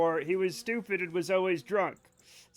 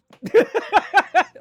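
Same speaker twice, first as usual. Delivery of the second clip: big laugh; 13 semitones higher, 14 dB louder than usual. Real, not acted. Cracking up at joke.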